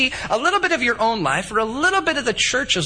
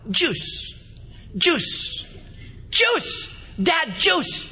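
put-on small child begging for juice, pitch climbing higher each time; a two-year-old pestering his dad, each "Juice" higher; toddler asking; 7 semitones higher, much slower than usual.

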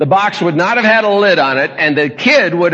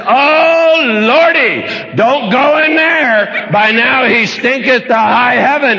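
fretful Martha; 5 semitones higher, slower than usual. Retold or imitated. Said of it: "And she said".

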